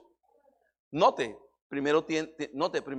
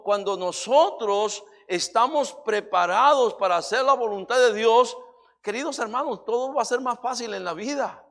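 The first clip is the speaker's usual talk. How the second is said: louder, impassioned preacher's voice, driving each phrase home; preaching at full force; 3 semitones higher, 5 dB louder than usual. Acted. Pastor urges listeners to know God's will, preaching loudly. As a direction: preach it hard to the congregation. Preacher builds to loud climax.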